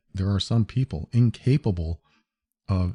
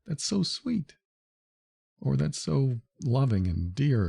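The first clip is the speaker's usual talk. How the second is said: mock teary gushing; 5 semitones higher, 3 dB quieter than usual. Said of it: "now I have to hold back the tears".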